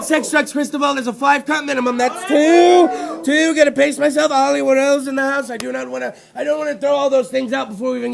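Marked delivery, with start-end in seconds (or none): none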